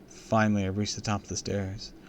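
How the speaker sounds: usual speaking voice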